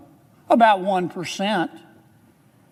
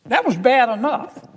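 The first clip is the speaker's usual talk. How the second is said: normal talking voice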